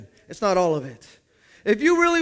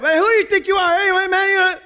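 high, loud challenging yell; old-way confrontation; 10 semitones higher, 6 dB louder than usual. Retold or imitated. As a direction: square up and shout him down. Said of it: "The old way would tell somebody else".

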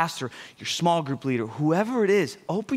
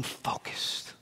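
whisper; 13 dB quieter than usual. Acted. Pastor whispering to the congregation about focus. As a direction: breathe the word out in a whisper. Sudden hush on key word.

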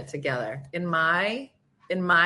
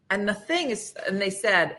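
usual speaking voice

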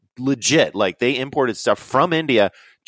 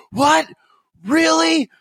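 high, loud disbelieving what, really; incredulous; 6 dB louder, slower than usual. Retold or imitated. Reacting to a friend's demon story, high and startled. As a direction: squeak out the shock.

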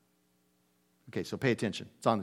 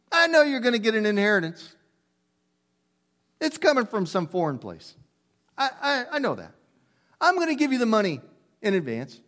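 rabbi playing along; 6 semitones higher than usual. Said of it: "I gotta do this".

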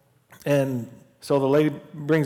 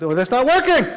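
surprised exclamation; 10 semitones higher, 6 dB louder than usual. Retold or imitated. Retelling squinting at eye test.

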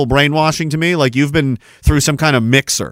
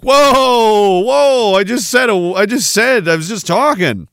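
much higher, louder, flustered protest; mock defensive; 8 semitones higher, 4 dB louder than usual. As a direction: backpedal like you've been caught out. Mocking a defensive overreaction.

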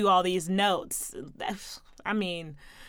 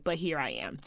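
whisper; 8 semitones lower, 9 dB quieter than usual. Real, not acted. Quiet aside after joke.

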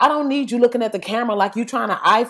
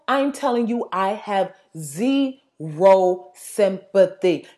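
ordinary delivery

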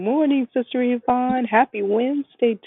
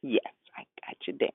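whispering; 7 semitones lower, 5 dB quieter than usual. Real, not acted. Host answers quietly on the call.

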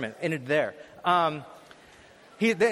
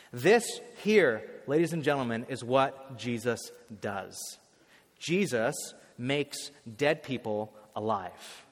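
slow preaching climax; 6 semitones lower, slower than usual. Acted.

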